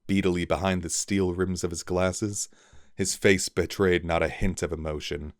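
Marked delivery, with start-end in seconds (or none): none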